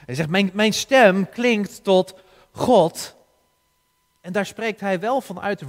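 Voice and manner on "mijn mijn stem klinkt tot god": louder and slower, stem stressed hard; crying out. Shown as a demonstration. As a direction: cry the verse out loud to God. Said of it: "En dat was niet genoeg voor hem".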